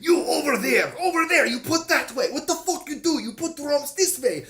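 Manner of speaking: put-on loud, high barking orders in a thick Slavic accent. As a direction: bark it like an Eastern European bar owner bossing kids. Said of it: "in some sort of Slavic tongue".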